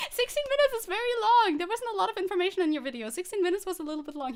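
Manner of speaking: high, loud rant that gradually settles; amused complaining. Real, not acted. Streamer complains the video was too long, high-pitched amused protest. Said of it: "I have to complain".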